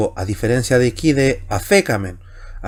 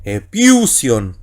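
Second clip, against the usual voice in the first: "epiusion" slowly, with the accented syllable punched; slow teacher's demonstration of the stressed syllable in "epiusion"; marked acute accent; much slower than usual. Shown as a demonstration. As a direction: lean hard on the acute accent in "epiusion", drawing the word out. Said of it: "marco ahí también el acento agudo".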